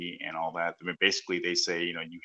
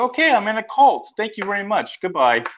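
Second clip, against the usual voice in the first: high, loud mock sign-off; brisk dismissal; 13 semitones higher, 7 dB louder than usual. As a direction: act out a hasty, emphatic exit. Retold quick exit from a cult.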